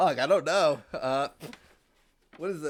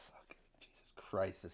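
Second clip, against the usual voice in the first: sighing under breath; exasperated whisper; 7 semitones lower, 14 dB quieter than usual. Real, not acted. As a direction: whisper it in dread. Dreading swallowing, whispered sigh.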